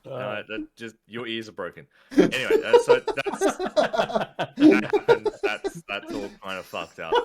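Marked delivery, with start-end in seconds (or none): 2.2-5.8 much higher and louder, cracking up, dragged out slowly; laughing. Real, not acted. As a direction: lose it while trying to move on. Breaks up laughing mid-sentence.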